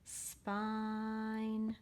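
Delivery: "Spine" with blended sp and long held I; blended sounding-out. Shown as a demonstration. Teacher showing a beginning blend, "Spine" drawn out. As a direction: stretch "Spine" slowly in one smooth breath. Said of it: "blend it in the beginning".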